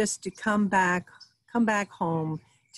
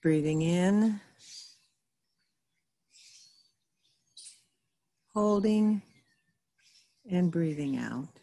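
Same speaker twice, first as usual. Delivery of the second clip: guided meditation. Real, not acted. Reverend guiding listeners into stillness through breathing, calm guided meditation. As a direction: lead them gently through each breath, leaving space.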